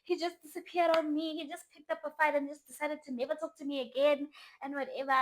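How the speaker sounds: high, loud upset venting; acted frantic complaint without real tears; distraught. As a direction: pour it out to a friend in distress. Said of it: "I was crying".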